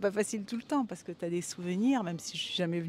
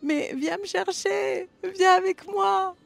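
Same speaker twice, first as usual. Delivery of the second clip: much higher, louder and slow, crying out to the ex; hammed-up pleading cry; desperate plea; 12 semitones higher, 10 dB louder, slower than usual. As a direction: beg at the top of your voice to be held. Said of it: "on a envie de lui dire".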